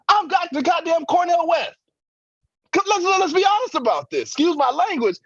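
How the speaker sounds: booming Cornel West pulpit shout. Retold or imitated. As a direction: roar it like a fired-up preacher.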